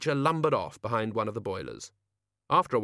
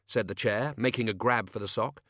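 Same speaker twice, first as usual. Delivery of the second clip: ordinary delivery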